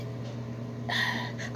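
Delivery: long unvoiced weary sigh; heavy sigh. Real, not acted. Vlogger sighing, tired of the topic. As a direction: let out a tired breath. Speaker sighs.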